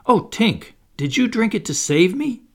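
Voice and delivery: high, pleading question; moved realisation. A narrator's reading. Peter realises Tink's sacrifice.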